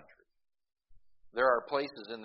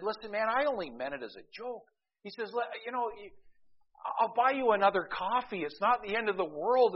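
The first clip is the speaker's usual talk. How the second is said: apologetic trucker; 11 semitones higher, 4 dB louder than usual. Retold or imitated. Truck driver apologizes in joke.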